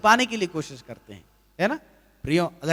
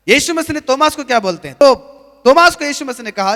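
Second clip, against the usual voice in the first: loud, rising preacher's call to confess; fervent sermon delivery; preaching at full force; 6 semitones higher, 8 dB louder than usual. Acted. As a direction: drive each line home to the congregation.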